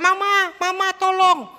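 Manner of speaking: put-on high, loud child's cry for mama; child screaming for help. Child carried off by a deer calls his mother, high panicked cry. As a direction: cry out like a terrified kid being carried off. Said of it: "anak ini teriak-teriak".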